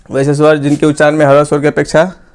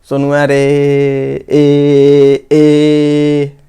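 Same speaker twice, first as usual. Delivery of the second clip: calling out; much slower than usual. Shown as a demonstration. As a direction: call Sonu from across the yard, stretching the ए. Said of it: "किसी को जैसे पु-, पुकारते हैं".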